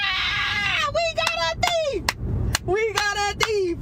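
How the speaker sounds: mock shouting alarm; calling out a thief. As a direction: sound the alarm like a siren.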